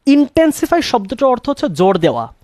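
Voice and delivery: ordinary delivery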